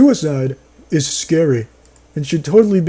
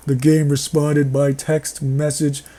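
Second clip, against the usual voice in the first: ordinary talk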